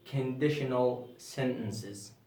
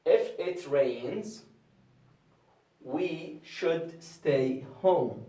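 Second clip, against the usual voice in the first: slow example reading; 2 semitones higher, much slower than usual. Shown as a demonstration. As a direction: read it slowly, word by word.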